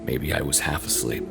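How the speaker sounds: ordinary delivery, nothing marked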